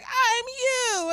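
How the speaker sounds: put-on high, drawn-out little-kid voice; sweet high-pitched child's reply; cute little girl. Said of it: "It was so cute how she said it".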